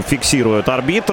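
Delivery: ordinary talk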